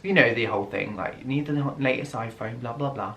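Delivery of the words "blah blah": normal talking voice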